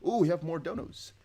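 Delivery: ordinary talk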